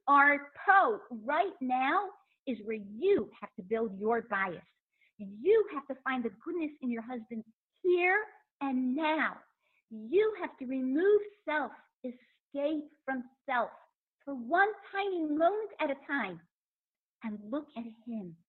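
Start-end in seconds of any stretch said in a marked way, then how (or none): none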